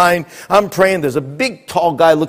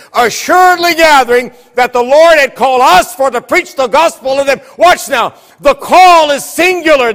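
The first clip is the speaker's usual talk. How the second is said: high, driving preacher delivery; full-force preaching; 10 semitones higher, 4 dB louder than usual. Real, not acted. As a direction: build urgency for the congregation, pitch held high.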